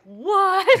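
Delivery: high, loud shocked blurt; loud incredulous "what"; retold shock. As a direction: burst out in disbelief, half laughing. Retold shocked reaction to song lyric.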